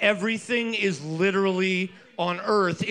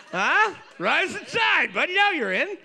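high hype cheer; 9 semitones higher than usual. Acted. Cheering a team on.

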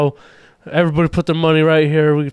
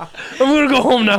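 mock crackhead repeat; 6 semitones higher than usual. Friend repeats Marky line.

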